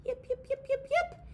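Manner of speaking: high excited puppy yips; high yipping pup. Acted. Storyteller yipping high as the wolf pup. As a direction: yip like a little wolf pup.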